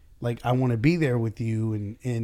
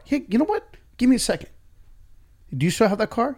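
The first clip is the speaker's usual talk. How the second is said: much higher, louder eager offer; eager helper; 12 semitones higher, 6 dB louder, faster than usual. Retold or imitated. Boss plays rep offering a new kit to a customer, eager and bright. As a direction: bounce in with a helpful fix. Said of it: "Be like".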